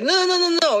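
high, rapid-fire retold no's; insistent denial. Colombian fans dismissing her explanation, insistent denial. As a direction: imitate stubborn fans refusing to hear it. Retelling friends' stubborn refusal.

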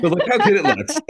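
laughing while showing off; proud laugh through the words. Real, not acted. Showing off the back piece to the hosts, laughing.

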